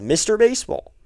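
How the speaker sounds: ordinary delivery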